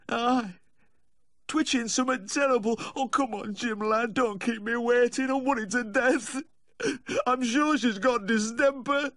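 hammed-up blubbering, worried to death; breaking down weeping. A narrator's reading. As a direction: plead and sob in a high voice. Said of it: "He broke down again".

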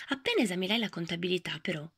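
plain reading voice

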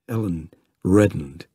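ordinary delivery

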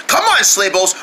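ordinary talk, nothing marked

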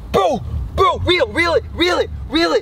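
high, urgent reel it calls; frantic and high-pitched; frantic excitement. Real, not acted. Fish caught, frantic reeling.

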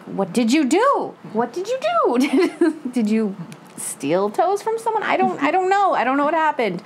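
rising high, bewildered what did you do; baffled questioning. Retold or imitated. Reacting to a dramatic line in the book, high and baffled. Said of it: "I'm like".

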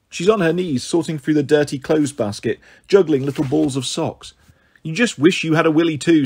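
plain reading voice

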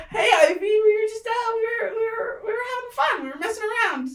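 very high squeaky, stammering excuse; tiny bully covering up when caught; caught-out little creature. Acted. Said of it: "Waffles and Bacon just immediately stop".

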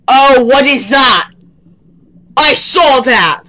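loud, slow startled exclamation; startled alarm. Real, not acted. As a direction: call out what you just spotted, drawn out. Spots something in horror game.

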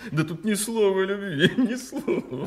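high voice through laughter; relieved laughing, voice up high; laughing with relief. Acted. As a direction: chuckle it out, greatly amused. King laughs at the letter.

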